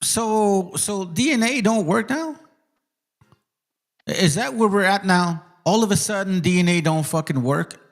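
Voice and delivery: high-pitched disbelieving questioning; real incredulous challenge. Real, not acted. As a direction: halt everything, baffled by the claim. Stops video in disbelief at claim.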